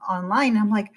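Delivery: normal talking voice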